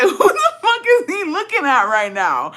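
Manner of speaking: high, loud imitation of an indignant man; mock outraged challenge. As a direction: yell it high, half laughing. Acting out his angry challenge.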